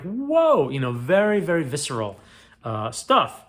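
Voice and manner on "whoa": a much higher, louder imitated whoa of shock; amazed whoa. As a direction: gasp it out like the audience seeing a beheading. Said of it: "You're like".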